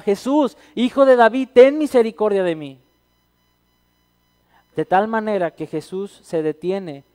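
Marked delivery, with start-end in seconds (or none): 0.0-2.8 high, loud desperate cry for mercy; shouting louder. Retold or imitated. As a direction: shout it over the crowd. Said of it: "más fuerte gritaba".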